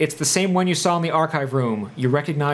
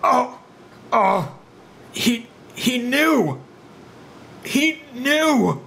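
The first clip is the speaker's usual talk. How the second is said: high, slow, drawn-out last words; hammed-up death gasp with long pauses; dying man; 7 semitones higher, much slower than usual. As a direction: stretch it out like a man expiring. Game master voices a slumping, dying man.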